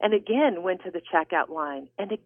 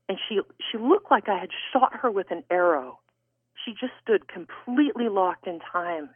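usual speaking voice

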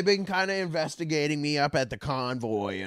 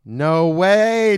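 excited; 6 semitones higher, 10 dB louder than usual. Real, not acted. Excited reaction to gun news.

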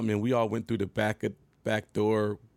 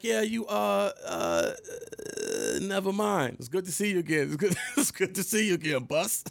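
higher, hesitant, half-laughing reply; nervous self; 7 semitones higher than usual. Retold or imitated. Backing down in front of a bigger [name], higher nervous voice. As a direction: swallow the complaint and stay polite. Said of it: "I'm like".

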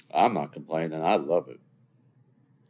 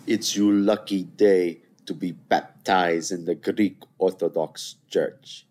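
slow, loud, hammed-up movie line; movie quote impression; 6 dB louder, slower than usual. Quoting a beloved movie about a Greek baptism, hammed-up proclamation. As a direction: proclaim it like a grand film character, drawn out. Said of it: "I don't think I did that properly".

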